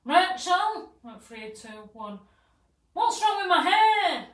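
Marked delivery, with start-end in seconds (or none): 0.1-0.9 high, loud shout for Rachel; acted yell. Acted irate shout from upstairs. 2.9-4.2 high, loud, drawn-out wail; acted alarmed yell. Retold upset woman yelling about hair.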